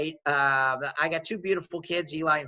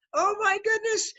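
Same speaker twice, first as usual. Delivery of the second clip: much higher, marveling gasp; amazed exclamation. Real, not acted. Founder marveling at the Microsoft buyout, much higher. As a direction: relive the thrill of the buyout.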